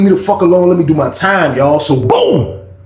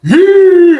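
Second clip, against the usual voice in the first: hype shout; 12 semitones higher, 8 dB louder than usual. Real, not acted. Hyping up his wrestling.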